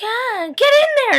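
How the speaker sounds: high, loud, drawn-out order; urgent shout. Acted. Tyler's mom in the cellar, high urgent shout. Acted shove into cell.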